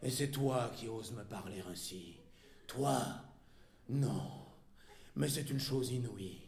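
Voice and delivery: low, hushed, halting outrage; contained fury. A narrator's reading. Wife disbelieving her husband dares speak so, contained fury. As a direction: hold the rage down, word by stunned word. Choked fury after the insult.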